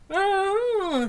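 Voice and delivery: high, loud gasped ah with a mouth forced open; strained ah, mouth held open; strained ah through a blocked mouth. A narrator's reading. Scully, tooth propping her mouth open, strains to speak. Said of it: "struggling to form words".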